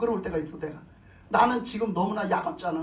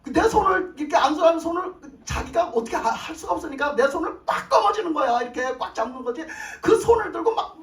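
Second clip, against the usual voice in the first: higher, louder, caught up in the moment; excited retelling; 6 semitones higher, 4 dB louder than usual. A narrator's reading. A pastor reliving the Spirit's power before the congregation, high and loud with excitement. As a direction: build the thrill of the healing. Retelling the intense laying on of hands.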